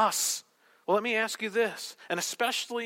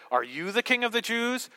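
YOU lifted high early, rest stays up; "Are YOU the King", emphasis up front; stress on YOU; 4 semitones higher than usual. Shown as a demonstration. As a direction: lean on the word YOU as a question. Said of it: "different ways that he could have said this".

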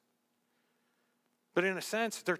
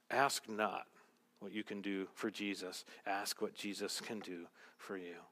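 soft quote; 11 semitones lower, 10 dB quieter than usual. Real, not acted. Quoting Kennedy-style line.

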